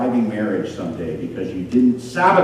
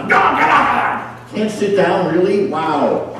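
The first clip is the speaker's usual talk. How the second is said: mock sergeant yell; 7 semitones higher, 4 dB louder, slower than usual. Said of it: "Just keep screaming".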